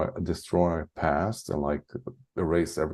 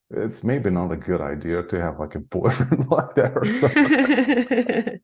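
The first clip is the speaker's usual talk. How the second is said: laughing quote; 6 semitones higher, 4 dB louder than usual. Retold or imitated. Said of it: "and I was like".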